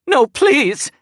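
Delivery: high, loud, desperate plea; begging someone unseen for mercy; frightened pleading. Acted. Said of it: "continued to protest".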